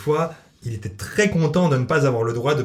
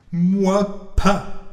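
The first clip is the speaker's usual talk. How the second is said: smug cat; 7 semitones higher, 4 dB louder than usual. Acted. Cat answers Zelda smugly.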